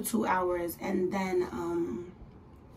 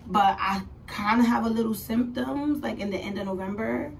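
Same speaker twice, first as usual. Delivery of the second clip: ordinary delivery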